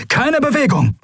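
high-pitched sharp order; called-out command. A narrator's reading. Pirate storming the ship orders everyone to freeze, called out high. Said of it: "rief er".

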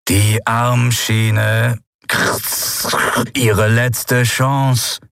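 slow, drawn-out mechanical voice through a grille; crackling machine voice. Acted. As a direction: speak like a machine with a rasping speaker. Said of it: "aus dem die Stimme rauschte".

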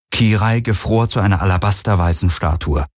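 ordinary delivery

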